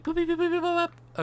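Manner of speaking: high mouthed beeps and boops; machine beeping. Pretend. Pretend machine doing calculations for co-host, high beeping. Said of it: "It's going like".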